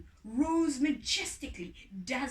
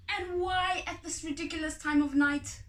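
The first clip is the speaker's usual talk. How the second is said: higher, louder complaint; annoyed; 6 semitones higher, 5 dB louder than usual. Acted. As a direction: grumble at the late hour. Grumbling farmer at night.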